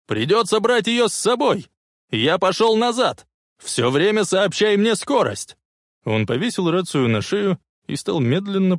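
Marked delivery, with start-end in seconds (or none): 0.1-5.6 high, urgent calling; shouted orders. A narrator's reading. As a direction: shout instructions into a radio. Shouted orders over the radio. (громкие срочные команды)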